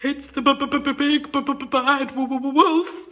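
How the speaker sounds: teeth chattering. Acted. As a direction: chatter each word with fear. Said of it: "Boris chattered".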